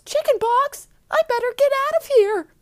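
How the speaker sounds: very high, loud fake voice; Karen. Disguised girl reacts to chickenpox news, loud fake voice. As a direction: squeal it in a hammed-up disguise voice. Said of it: "In an obvious fake voice".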